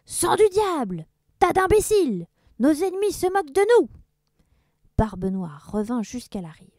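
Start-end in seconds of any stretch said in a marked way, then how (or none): 0.1-3.9 high, loud cursing, speeding up; furious yell. Acted. Mocked Blackbeard curses his crew, furious. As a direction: rage at the crew, faster at the end. Pirate enraged.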